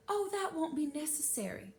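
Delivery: sweetly dismissive. Acted. Spencer dismissing offer sweetly.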